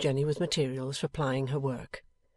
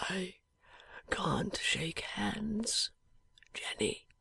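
barely voiced, dying breath; a dying man's faint whisper; 4 semitones higher, 6 dB quieter, much slower than usual. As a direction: whisper it weakly from a sickbed.

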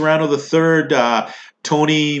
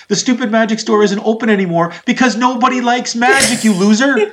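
hammed-up scornful rant, pitched up; mock rant at a kid; 7 semitones higher than usual. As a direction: sneer at the kid magician, rising to a jab. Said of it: "And I'm just like".

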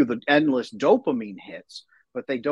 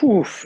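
loud, higher blown-out exhale; heavy "oof"; 9 semitones higher, 7 dB louder than usual. Real, not acted. Father of four asked about fatherhood, overwhelmed puff. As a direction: puff out at the size of the question.

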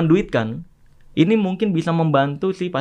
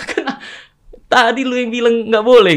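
loud, higher mock accusation; raised-voice retelling of a quarrel; retold angry challenge; 6 semitones higher, 5 dB louder than usual. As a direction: imitate an indignant man confronting you.

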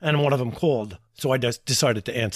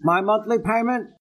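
higher, dim-witted caller voice; put-on dopey voice; 4 semitones higher, slower than usual. Pranking the mortgage caller in a higher, dopey voice. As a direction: play dumb for the telemarketer.

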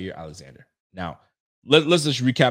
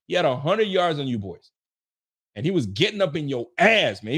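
ordinary talk, nothing marked

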